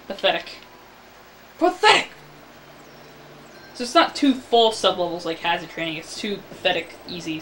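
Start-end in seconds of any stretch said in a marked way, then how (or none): 1.6-2.1 much higher, sharply exclaimed; high, loud outburst of contempt; disgusted exclamation. Real, not acted. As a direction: burst out with scorn. Disgusted repeat of the word.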